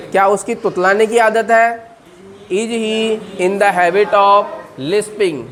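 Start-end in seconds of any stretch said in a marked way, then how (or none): 2.5-5.5 very slow, careful question; slow model question. Shown as a demonstration. Teacher drills the question very slowly for the class. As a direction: stretch it out for repetition. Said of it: "बोलिए".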